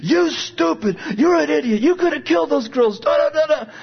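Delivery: high scolding uncle; retold angry yelling. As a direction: berate him like a furious uncle. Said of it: "My uncle's yelling at me".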